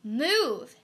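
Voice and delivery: a loud, sharp order. Acted. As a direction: make her move this time, loudly. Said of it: "She will not budge".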